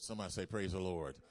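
rally the room to answer back; call to congregation. Acted. Call and response.

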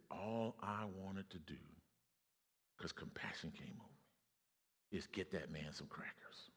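slow, soft, compassionate words; tender hush. Retold or imitated. Wife to husband, quietly tender. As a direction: ease it out gently, softened by compassion. Wife's compassionate inner reply.

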